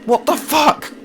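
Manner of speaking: very high, loud, disbelieving yelling; frantic outrage. Real, not acted. Gamer stuck before the finish line, frantic high yelling. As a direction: scream at the game that won't let you through.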